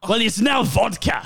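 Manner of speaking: put-on Russian accent.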